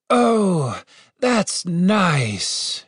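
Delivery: high, drawn-out blissful moan; moaning with relief. A narrator's reading. Healed guard under the spell, blissful moaning. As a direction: moan the words in pleasure. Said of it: "he said between moans".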